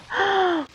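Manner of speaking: high, drawn gasp; shocked gasp. Real, not acted. Gamer sees Jimmy walk out, shocked gasp. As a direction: gasp at the betrayal.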